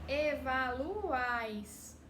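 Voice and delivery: evaluais stretched very slowly; teacher modeling a Spanish triphthong; slow demo. Shown as a demonstration. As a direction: say evaluais slowly, vowels held. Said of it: "U A I".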